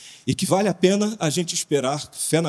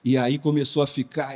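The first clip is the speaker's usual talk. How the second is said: ordinary talk, nothing marked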